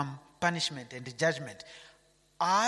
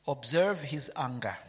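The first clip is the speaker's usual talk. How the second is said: ordinary delivery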